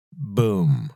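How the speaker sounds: ordinary talk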